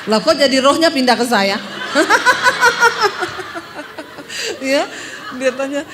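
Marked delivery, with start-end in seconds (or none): 1.6-4.6 high, loud long laughter; real burst of laughter. Real, not acted. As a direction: laugh hard at the joke. Preacher laughs at her own joke.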